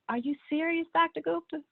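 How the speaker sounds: high-pitched, retold disbelief; incredulous. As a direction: question the doctor as if you can't believe it. Retells her disbelief to doctor.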